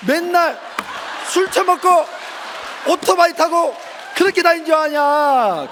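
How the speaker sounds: high, loud scolding shout; retold shout. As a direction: hammer each phrase out at the boy. Father shouting back at son. (높고 크게 소리치며 꾸짖기)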